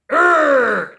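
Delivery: loud, high frustrated groan; angry groan. Retold or imitated. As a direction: let out a furious grunt. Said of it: "Angry face".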